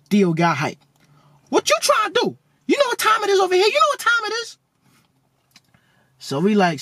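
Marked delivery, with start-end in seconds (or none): 1.5-4.6 very high, squeaky voice out of a big man; Theo's squeaky voice. Retold or imitated. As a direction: squeak every nah up high like Theo. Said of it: "his voice is squeaky as hell".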